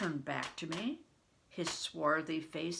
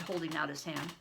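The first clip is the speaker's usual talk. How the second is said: plain reading voice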